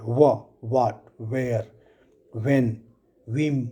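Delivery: what, where, when, whim begun with H and W joined; joined H-W sound. Shown as a demonstration. Teacher demonstrates WH in what, where, when, whim, H joined to W. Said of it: "ह और व का joint sound आता है".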